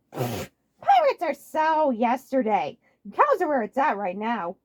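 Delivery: slow, high, loud smug drawl; smug dismissal. Acted. Waluigi dismissing pirates, smug slow high drawl. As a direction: brush it off smugly. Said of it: "he chuckled".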